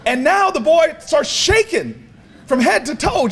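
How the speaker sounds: ordinary talk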